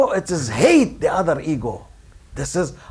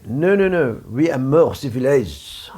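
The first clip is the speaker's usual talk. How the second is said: lower, mock French accent; 6 semitones lower than usual. Preacher mimics the French boasting of civilization, French accent. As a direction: put on a haughty French accent. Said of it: "The French will say".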